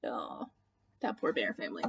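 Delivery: ordinary delivery, nothing marked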